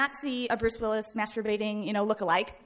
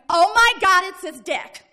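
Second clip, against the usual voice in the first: high, loud shocked yell that drops off at the end; retold scream; 6 semitones higher, 11 dB louder, slower than usual. Classmate at the back of the bus, shocked shout. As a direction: shriek the first half, then let it fall. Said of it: "I hear someone scream".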